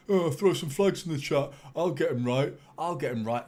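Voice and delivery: a cocky boast, imitating his earlier self; mock cocky self. Streamer mocking his own cocky boast to chat, loud and smug. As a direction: puff up and brag that he'll nail every flag. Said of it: "I, I cocky".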